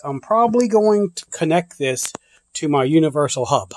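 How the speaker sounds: ordinary talk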